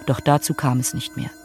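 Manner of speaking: ordinary talk, nothing marked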